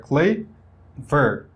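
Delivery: "clever" in two separate beats, CLE, then ver; split into syllables. Shown as a demonstration. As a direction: break "clever" after cle with a pause. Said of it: "clever هم دو هجا داره".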